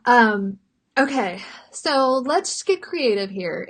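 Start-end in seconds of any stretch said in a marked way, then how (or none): none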